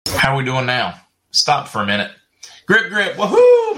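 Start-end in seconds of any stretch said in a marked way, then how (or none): 2.7-3.8 high, loud woohoo with a laugh; real laughing cheer; excited cheer. Real, not acted. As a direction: whoop it out with delight. Cheering over son's birthday tickets.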